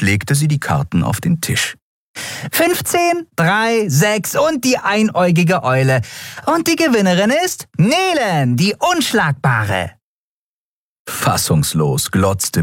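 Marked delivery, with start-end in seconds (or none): 2.5-9.9 triumphant Nelen. A narrator's reading. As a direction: crow over the winning hand. Nelen lays down winning cards.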